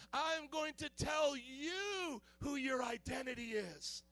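slow, high, shouted accusation; devil's taunt. Acted. Devil telling Christians who they are, slow shouted taunt. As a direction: draw out each word as a loud condemnation. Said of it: "it's like the devil comes to us".

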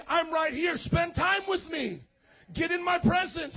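high, ringing preacher's plea; impassioned divine call. Acted. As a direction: cry out as God calling people close. Voices God calling to churchgoers.

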